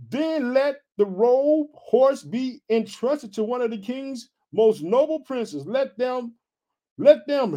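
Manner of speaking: slow, high-pitched Bible reading; scripture reading. A narrator's reading. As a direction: stretch out the verse for the congregation. Reads scripture aloud.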